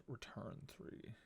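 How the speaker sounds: usual speaking voice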